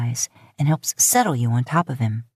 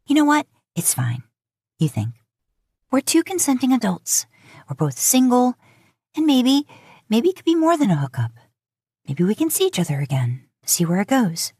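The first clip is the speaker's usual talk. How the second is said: higher, brighter rush of thoughts; hopeful inner voice; 7 semitones higher than usual. A narrator's reading. Woman reassures herself about the night, higher and hopeful. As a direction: talk yourself into hope.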